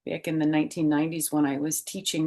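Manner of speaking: ordinary delivery, nothing marked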